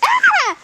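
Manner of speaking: sudden high startled shriek. Acted. Cinderella shrieks when the kid grabs her shoe. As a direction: yelp as the shoe is yanked.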